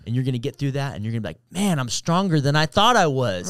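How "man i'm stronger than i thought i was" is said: higher and louder, triumphant self-talk; proud inner voice. Pretend. Swimmer cheering herself on after the wave, higher and louder.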